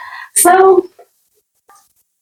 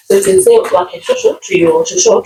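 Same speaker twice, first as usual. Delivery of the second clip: normal talking voice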